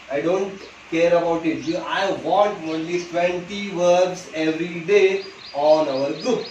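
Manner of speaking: every word of I want only twenty verbs every day spaced out; very slow firm demand. Shown as a demonstration. Teacher setting daily verb homework, slow firm demand. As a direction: lay down the rule slowly. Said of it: "teacher insists daily homework".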